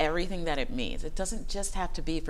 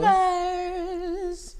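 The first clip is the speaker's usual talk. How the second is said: high playful; 14 semitones higher, 4 dB louder than usual. Pretend.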